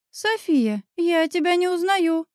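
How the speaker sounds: lightly staged astonishment; theatrically shocked. A narrator's reading. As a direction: act playfully scandalized at a friend. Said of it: "картинно шокировалась Оля".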